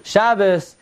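ordinary delivery

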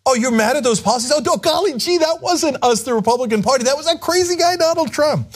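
high, fast golly-gee innocence; wholesome 1950s kid. Retold or imitated. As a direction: play the innocent, wide-eyed and high. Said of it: "then go".

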